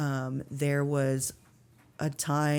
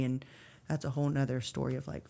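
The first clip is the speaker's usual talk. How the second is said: ordinary talk, nothing marked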